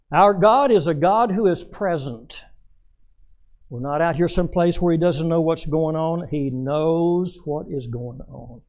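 loud, high, slow preacher's cry; loud revival preaching; preaching at full force. Acted. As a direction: thunder it out to the congregation, drawing out the last line slowly. Preaching God's presence.

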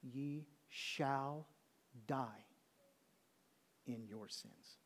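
slow and low, long pauses between phrases; hushed, weighty verdict; solemn pronouncement. Acted. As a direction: pronounce it gravely, word by word. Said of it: "He didn't say".

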